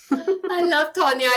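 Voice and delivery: gushing. Real, not acted. Gushing over her co-host.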